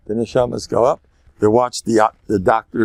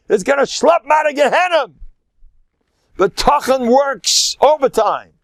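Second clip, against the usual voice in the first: fervent preaching; 12 semitones higher than usual. Acted. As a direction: preach it with full fire to the crowd. Preaching the Ramchal's teaching.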